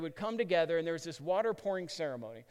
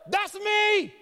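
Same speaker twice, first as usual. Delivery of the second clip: very high, loud, drawn-out holler; hammed-up attention-grabbing yell; mock screaming out; 12 semitones higher, 9 dB louder, slower than usual. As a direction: scream it out over a hushed crowd. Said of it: "you just scream out".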